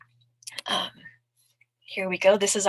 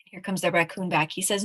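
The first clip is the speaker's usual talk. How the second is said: plain reading voice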